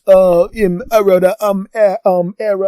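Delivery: hammed-up panicked stammer; mock flustered friend. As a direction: fumble for words, busted.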